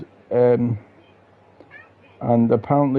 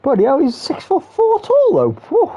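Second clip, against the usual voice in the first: much higher, exclaiming in amazement; astonished. Real, not acted. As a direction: blown away by how tall he is. Shocked at player's height.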